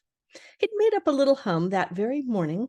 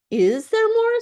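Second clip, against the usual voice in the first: much higher, louder hopeful question; eager and quick; 13 semitones higher, 6 dB louder, slower than usual. Acted. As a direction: Pooh hoping for more food. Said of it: "said Pooh quickly".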